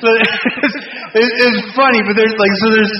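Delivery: trying to recover from cracking up; laughing while talking. Real, not acted. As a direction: talk through the tail of a laugh. Cracking up at his own story.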